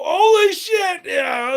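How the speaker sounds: high, loud startled yelp; startled yell. Retold or imitated. Retelling his scooter crash to chat, loud startled yell. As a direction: yelp in shock as the scooter goes into the rock. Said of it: "and I go".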